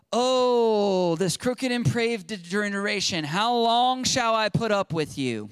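loud, high cry of frustration; exasperated outburst. Retold or imitated. Jesus fed up with a crooked generation, loud exasperation. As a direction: let the frustration burst out on the first word. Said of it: "getting frustrated is not a sin".

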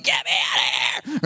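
low mock demon shout; demon desperate to escape. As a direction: cry it out like a demon that can't stand it. Mimics demon fleeing Holy Spirit.

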